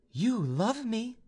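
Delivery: high, slow feminine character voice; a slow, high-pitched direct question; young noblewoman. A narrator's reading. Vaninka confronts the young man who loves her, high and slow. Heroine's question in narrated dialogue.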